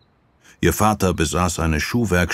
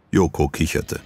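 usual speaking voice

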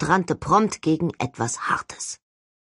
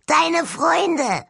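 high, shouted skull jeer; gloating skull voice; 11 semitones higher than usual. A narrator's reading. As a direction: fling the answer with glee. Skull answers with relish.

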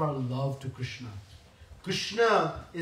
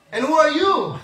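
challenge; 9 semitones higher, 8 dB louder than usual. Acted. Yashoda challenging Balram.

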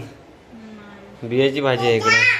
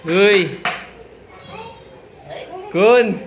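calling out; 9 semitones higher, much slower than usual. Real, not acted. Greeting son on arriving home.

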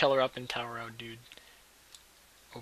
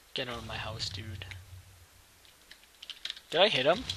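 ordinary talk, nothing marked

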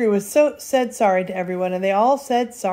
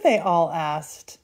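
ordinary delivery, nothing marked

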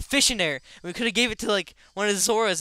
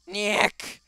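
higher put-on squawk; screaming boss cry; 4 semitones higher than usual. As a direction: imitate the boss's short cry. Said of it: "he's screaming".

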